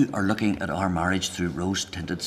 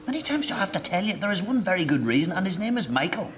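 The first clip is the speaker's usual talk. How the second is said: much higher, insistent exasperation; exasperated; 12 semitones higher than usual. Acted. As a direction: spell it out with the pitch shooting up. Exasperated, repeating himself to Elizabeth.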